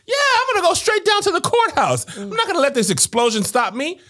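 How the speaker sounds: sarcastic mock bravado. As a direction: ham up fake courage, laughing. Sarcastic mock of brave victim.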